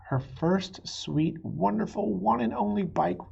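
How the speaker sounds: slow, rising, lovingly stretched words; fond. A narrator's reading. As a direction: dwell lovingly on each word. Fond list about her bike.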